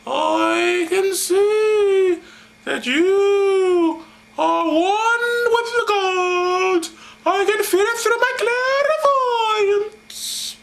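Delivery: high, very slow, spooky prophetess; possessed seer voice.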